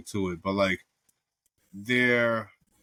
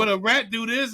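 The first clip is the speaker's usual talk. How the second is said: mock daughter; 11 semitones higher, 4 dB louder than usual. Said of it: "she's like".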